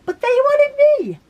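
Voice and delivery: high, loud, delighted exclamation; thrilled squeal. Real, not acted. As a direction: squeal it with real delight at being picked. Thrilled at being chosen by magazine.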